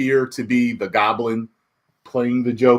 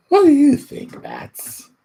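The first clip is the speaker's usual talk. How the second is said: Joker voice; 4 dB louder, slower than usual. Acted. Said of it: "Joker addressing Batman".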